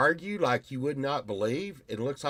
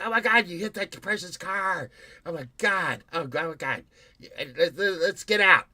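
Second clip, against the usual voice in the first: mock panicked wife; 5 semitones higher, 3 dB louder than usual. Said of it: "she'll be like".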